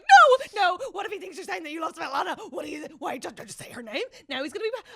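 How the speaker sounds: fast, high, loud panicky pleading; frantic worried fan begging the character; panicked. Retold or imitated. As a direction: panic at the screen, talking fast. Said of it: "And I panicked".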